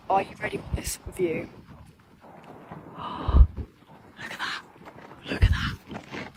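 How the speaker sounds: hushed awe. Real, not acted. Hushed awe at canyon view.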